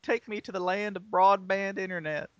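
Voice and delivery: mock pleading. Mock begging Google car.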